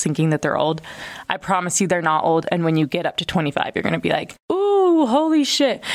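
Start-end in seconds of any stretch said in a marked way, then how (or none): 4.5-5.8 surprised. Pretend. Imagining reaching twenty-five.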